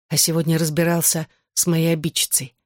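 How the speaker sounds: usual speaking voice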